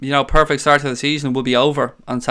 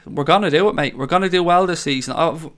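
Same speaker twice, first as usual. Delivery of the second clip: normal talking voice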